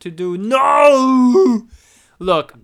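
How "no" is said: long, high, loud wail of protest; hammed-up "no" of horror; mock anguished cry. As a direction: cry out in mock despair, stretching it out. Mock dismay at statue being touched.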